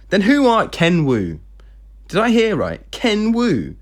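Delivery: high-pitched, incredulous questioning; acted indignation, stopping short of a shout; holding back shouting. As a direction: question it in strained disbelief. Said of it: "She gritted her teeth to stop herself from sh-, shouting".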